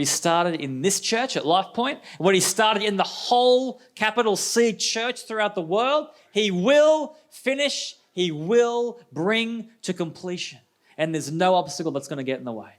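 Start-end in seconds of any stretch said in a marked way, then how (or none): 2.2-10.6 building promise. Real, not acted. Pastor's climax to the church.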